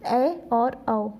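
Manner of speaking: ए and औ drawn out very slowly, each vowel held; slow vowel demo. Shown as a demonstration. Teacher showing students the two vowel sounds, very slowly. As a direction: spell out ए then औ slowly so students hear each one. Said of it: "दो मात्रा यानी कि".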